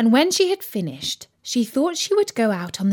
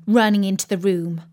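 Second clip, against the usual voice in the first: ordinary delivery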